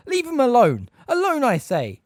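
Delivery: loud, high furious order; commanding shout. A narrator's reading. McGonagall ordering the wizards away from Hagrid, furious. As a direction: order them off with full authority. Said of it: "said Professor McGonagall's voice".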